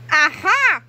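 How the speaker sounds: high, loud gotcha; triumphant yell. Acted. Principal Krupp catching the boys, loud triumphant yell. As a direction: catch them out with a shout. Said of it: "yelled Mister Krupp".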